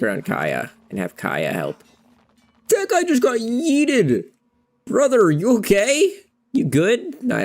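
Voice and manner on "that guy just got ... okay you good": high, drawn-out exclamation; shocked outcry. Real, not acted. Player seeing a fighter flung away, high shocked outcry. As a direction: cry out in amazed concern, stretched and high. Reacting to a character being thrown.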